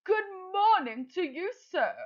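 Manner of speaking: high, excited mother crow; Madam Crow voice. Acted. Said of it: "I'm going to put on a voice".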